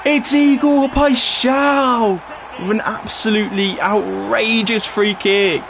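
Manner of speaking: high-pitched eruption, slowing on "outrageous free kick"; excited goal commentary. Real, not acted. Streamer celebrating an outrageous free kick, excited commentary.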